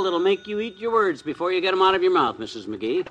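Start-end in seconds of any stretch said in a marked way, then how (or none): none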